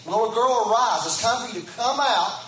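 rising preacher's cry, "Come out"; preaching call. Acted. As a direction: call them out of the tomb with full force. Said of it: "And he's saying".

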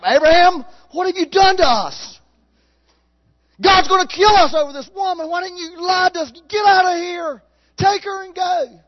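high, loud panicked yelling at Abraham; frightened shouting. Acted. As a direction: shout in a panic to get him out. Scared men shout at Abraham.

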